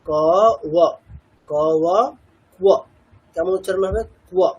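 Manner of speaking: ক then ওয়া, then blended into কুয়া; sound blending demo. Shown as a demonstration. Teacher joining ক and ওয়া for students, step by step. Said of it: "দুটোকে একসাথে করলে কি হবে?".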